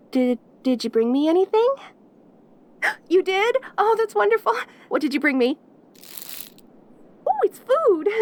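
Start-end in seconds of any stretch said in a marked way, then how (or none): 2.8-4.5 gasp, then higher and delighted; thrilled that someone remembered; excited gasp. Acted. Caged demon hears visitor brought a gift, excited. 7.3-8.2 much higher, squealing with delight; light up at the sight of food. Acted. Demon discovers the visitor's gift is food, delighted.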